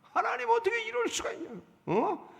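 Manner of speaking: very high, strained outburst at God; furious Jonah. Retold or imitated. Preacher voicing Jonah raging over the lost vine, high angry cry. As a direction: cry out at God in rising outrage. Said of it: "막 화를 내는 거야".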